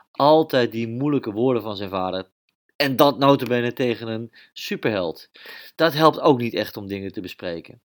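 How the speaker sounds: plain reading voice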